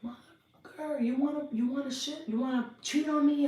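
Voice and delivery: high, rising screaming accusation; furious wife. Retold or imitated. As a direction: scream at the cheating husband. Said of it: "she was like".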